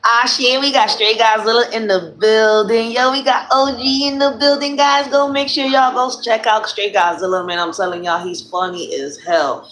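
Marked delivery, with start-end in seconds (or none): 0.1-7.5 excited announcement, pitched up; hyped shout-out. Real, not acted. Streamer announcing Straight Godzilla in the building, hyped. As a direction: hype up a guest to the crowd. Hyping a viewer who has arrived.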